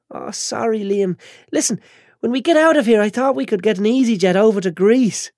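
put-on high, tearful, whiny girl; a bandaged girl fighting tears; whiny young woman. Chantal voiced.

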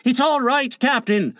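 high, easy reassurance; complacent. A narrator's reading. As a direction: answer the captain smugly at ease. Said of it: "Smee answered complacently".

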